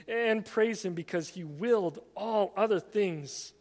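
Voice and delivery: loud, forceful read-aloud; emphatic quotation reading. Acted. Preacher quoting Francis Schaeffer on praising God, forceful reading.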